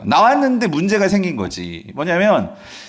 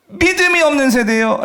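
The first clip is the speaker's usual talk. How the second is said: high-pitched acted rebuke; reenacted reproach to failing followers; harsh scolding; 11 semitones higher, 4 dB louder than usual. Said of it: "호되게 꾸짖은 사람들이 있었어요".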